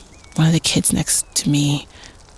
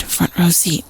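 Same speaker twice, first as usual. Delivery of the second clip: usual speaking voice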